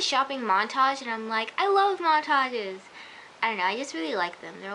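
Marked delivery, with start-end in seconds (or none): 1.6-2.8 slow, higher and louder gush. Real, not acted. Fan gushing about montages, slow and louder. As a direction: stretch it out like a giddy fan.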